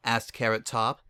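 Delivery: usual speaking voice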